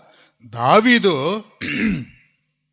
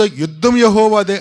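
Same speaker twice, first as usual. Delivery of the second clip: high, loud battle cry; loud proclamation; 5 semitones higher, 8 dB louder, slower than usual. Shown as a demonstration. Preacher cries the battle slogan to the church, loudly. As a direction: proclaim it with full conviction.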